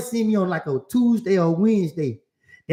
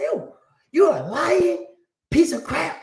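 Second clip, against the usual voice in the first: high, drawn-out indignant insult; acted-out exasperation, stretched for emphasis; mad lawyer; 9 semitones higher, slower than usual. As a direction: imitate the fed-up lawyer, slow and pitched up. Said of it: "you mad, ain't it?".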